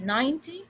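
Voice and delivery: Ninety with high NINE; Ninety, strong start and light ty; stress on first syllable. Shown as a demonstration. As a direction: say Ninety as NINE-ty, short ending. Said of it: "second syllable पे".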